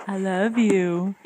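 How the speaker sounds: slow, tender and low. Real, not acted. Mother telling her little one she loves him, tender. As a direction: linger over each word lovingly. Parent tells toddler tenderly.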